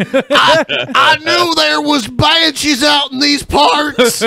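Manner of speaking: cowboy yelling in a high voice, laughing; hillbilly shout. Retold or imitated. Mocking Pimp Monk's banshee scare.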